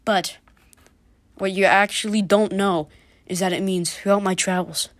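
ordinary delivery

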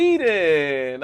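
loud, delighted call of the country name; excited shout. Real, not acted. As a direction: cry it out, thrilled by new listeners. Host reacts to Swedish listeners.

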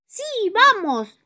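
high, slow, loud cartoon voice; eager little friend agreeing; Bibi's voice. Bibi agreeing to go rescue, high eager voice. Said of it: "dice Bibi".

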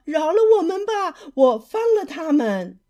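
high, loud begging shout. Acted. Fisherman to the shark, shouting for mercy. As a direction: cry out for mercy. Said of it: "渔夫喊道".

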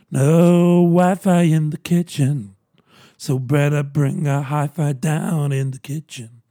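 slow, deliberate rhyming quip; slow sing-song wordplay; slow punchline delivery. Acted. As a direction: spell out the joke slowly. Drawn-out rhyming joke.

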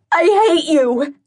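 high, loud, slow scream of hate; raw, drawn-out cry of rage; furious cry. Acted. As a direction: hurl it at the mirror with everything. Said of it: "I cry".

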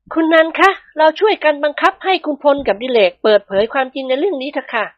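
high feminine character voice; rally an ally in a high, polite voice; determined wife. A narrator's reading. Prapha urges Nantha to force the men, determined.

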